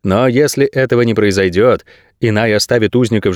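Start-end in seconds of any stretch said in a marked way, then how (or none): none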